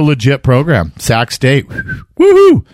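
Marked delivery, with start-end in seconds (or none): 2.2-2.6 high-pitched, loud "woohoo"; cheering falsetto whoop. Real, not acted. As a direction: whoop it up for the home team. Cheering for Sac State, NorCal.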